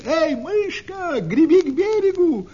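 high-pitched, carrying storybook shout; calling from shore. A narrator's reading. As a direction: call out across the water, stretching the hail. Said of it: "ребята кричат ей с берега".

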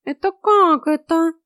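high, loud, drawn-out outrage; slow, high-pitched indignation; indignant cat. A narrator's reading. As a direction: splutter as an offended cat. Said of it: "От возмущения Васька аж задохнулся".

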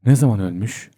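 usual speaking voice

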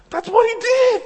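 very high, loud, strained mock voice; mock squeal. Dad mimicking a kid's answer, high squeal. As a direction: squeak it out in caricature. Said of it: "He's like".

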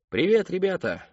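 high, hearty shouted greeting; clown calling out. A narrator's reading. Clown greets the birthday children, calling out. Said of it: "выкрикнул клоун".

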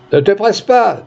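voice from crowd. A narrator's reading. Bystander advises the gamekeeper, calling out. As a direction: call out from among the onlookers. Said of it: "dit une voix".

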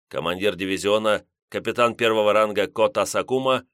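usual speaking voice

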